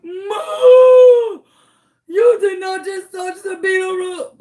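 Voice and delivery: high, loud hammed-up wail; horrified. Customer to waitress who touched roach, horrified.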